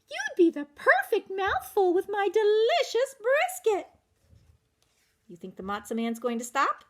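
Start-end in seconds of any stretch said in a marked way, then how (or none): 0.1-3.9 high eager auntie voice; Cousin Tilly. Acted. Cousin Tilly luring the matzah man to her brisket, high and eager. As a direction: coax him toward the brisket.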